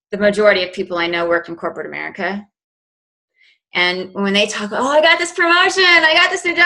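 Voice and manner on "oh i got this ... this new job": high, gushing impression of a coworker showing off; mock bragging coworker. Mimicking coworkers bragging about promotions and new jobs, gushing and high. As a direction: lay on the fake excitement, pitched way up.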